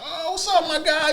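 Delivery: high, loud happy hello; excited greeting. Retold or imitated. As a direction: hail a buddy across the room. Greeting him again.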